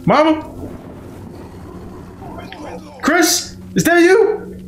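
high pitched creepy voice; mock villain voice. Friend voicing the game villain calling out, high pitched.

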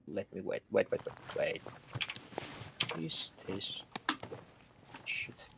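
unvoiced tense whisper. Real, not acted. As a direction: whisper so the enemy won't hear. Sneaking, hushed callout.

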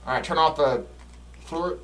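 ordinary talk, nothing marked

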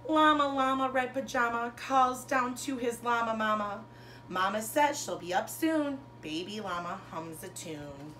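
lilting picture-book read-aloud, bigger on each Llama Llama; reading to children. Acted. Teacher reading a rhyming bedtime book to her class. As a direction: read it like a bedtime story for little kids.

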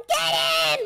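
high, egging-on yell; urgent shout. Pretend. Brother urging player to attack monster, urgent shout. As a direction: yell it as a battle order.

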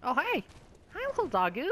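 high, cooing greeting. Real, not acted. Gamer spots a dog in game, high cooing hello. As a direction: coo at the cute dog.

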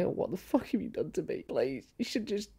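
high, pleading dog voice; mock pitiful dog begging its owner. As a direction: beg to go back, high and whiny. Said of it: "They cannot tell us".

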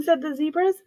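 plain reading voice

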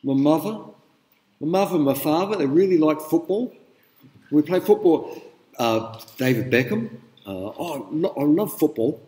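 broad Cockney demonstration voice; Cockney accent. Shown as a demonstration. Lecturer showing students what Cockney sounds like, Cockney accent. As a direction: put on Cockney for an example. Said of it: "you know what Cockney?".

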